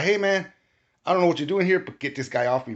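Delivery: high, loud appeal for help; urgent plea. Retold or imitated. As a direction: beg someone to pull an attacker off you. Pleading with intruder for help.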